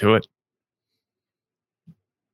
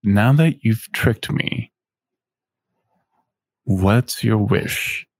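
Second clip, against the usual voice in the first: slow, hollow demon voice; a devil's echo from the dark, slowly; demonic echo voice; slower than usual. Acted. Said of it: "And he says".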